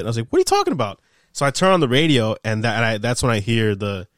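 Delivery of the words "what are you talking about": much higher, retold shocked disbelief; imitating his own stunned reaction, voice jumping up; disbelieving.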